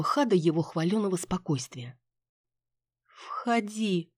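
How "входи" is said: drawn-out seductive invitation; languid drawl. A narrator's reading. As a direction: draw the word out languidly. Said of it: "томно протянула я".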